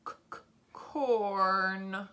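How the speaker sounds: "c, c, corn" with repeated hard c, then drawn out; sounding out. Shown as a demonstration. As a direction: stretch "corn" very slowly after tapping c. Sounding out while writing.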